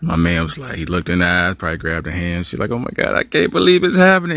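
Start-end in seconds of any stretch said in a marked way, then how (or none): 2.7-4.4 high giddy squeal; high thrilled gush; excited girlfriend. Acted. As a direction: gush it like the ring is coming. Girlfriend expects proposal.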